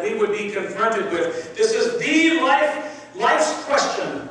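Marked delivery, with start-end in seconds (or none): 1.6-4.3 slow weighty stress on life; solemn emphasis; emphatic stress. Acted. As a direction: lean hard on life, drawn out. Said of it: "There is no other question".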